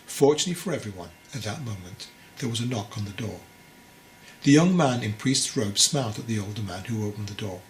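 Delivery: usual speaking voice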